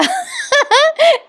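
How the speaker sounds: very high burst of laughter; real laugh. Real, not acted. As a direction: crack up at the lame jokes. Narrator laughs at jokes.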